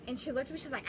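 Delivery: ordinary delivery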